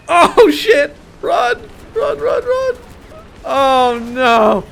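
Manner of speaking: panicked high yelling; screaming to flee a bomber; being chased. Real, not acted. Bomber chasing his tank.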